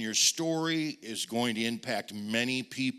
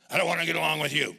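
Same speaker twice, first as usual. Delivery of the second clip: curt refusal; 3 semitones higher, 5 dB louder, much faster than usual. Retold or imitated. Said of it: "let's try and get along".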